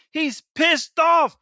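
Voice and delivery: high, slow, exaggerated outrage; angry. Preacher hamming up an angry God, high and slow. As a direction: stretch it out like God is fed up. Preacher describing God's anger.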